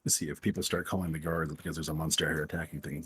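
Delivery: ordinary talk, nothing marked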